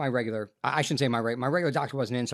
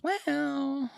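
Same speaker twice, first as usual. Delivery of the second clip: hedging doctor; 7 semitones higher, slower than usual. Retold or imitated. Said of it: "And he goes".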